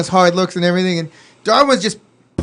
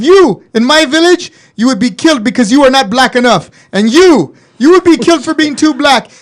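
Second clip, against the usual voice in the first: much higher and louder angry tirade, slowing on the jabs; furious rant; 8 semitones higher, 7 dB louder than usual. Retold or imitated. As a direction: tear into each of them in turn. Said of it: "And he goes".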